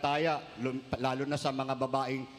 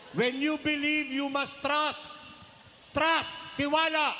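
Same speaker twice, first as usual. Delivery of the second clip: raised, emphatic sermon line; preaching; 9 semitones higher, slower than usual. Acted. Priest urges the congregation to trust, preaching with force. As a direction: lean into each word to convince them.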